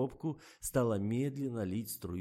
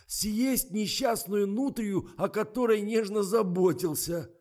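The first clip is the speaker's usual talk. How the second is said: very high, loud lament; horrified wail; 13 semitones higher, 10 dB louder, slower than usual. A narrator's reading. Misha protests eating the pet nutria, horrified wail. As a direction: wail in outrage over the poor animal.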